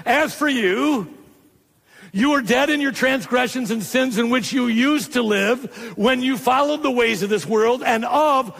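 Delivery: high, pressing run-on scripture reading; excited preaching. Retold or imitated. Preacher reading Paul's letter as an excited outpouring, excited. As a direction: read as Paul too excited to stop. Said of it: "'Cause he's so excited".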